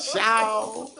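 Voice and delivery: very high loud "Ciao"; character sign-off. Acted. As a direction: toss it off in a squeaky character voice. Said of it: "Skillet and Booker".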